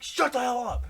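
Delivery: loud, high-pitched retort; imitated angry yell. Teased friend answers the group, yelling angrily. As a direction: shout down the teasing.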